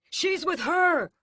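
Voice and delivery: high, loud, slow accusing cry; man loudly accusing the girl; exclaimed accusation. A narrator's reading. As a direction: point her out to the crowd. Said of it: "the man who did it exclaims".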